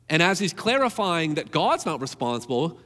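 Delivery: ordinary talk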